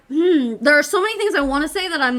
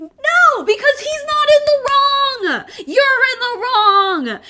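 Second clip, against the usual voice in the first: much higher and louder, slow, scolding; lightly mocking high voice; 12 semitones higher, 5 dB louder, slower than usual. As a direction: snap back in the put-on voice. Mock voice keeps going, then retorts.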